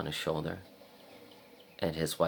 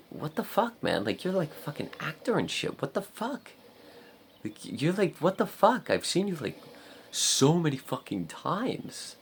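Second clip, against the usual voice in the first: high-pitched amazed gushing; imagined starstruck outburst; 11 semitones higher than usual. Retold or imitated. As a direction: gush at a star in squeaky disbelief. Imagined starstruck call to the actor.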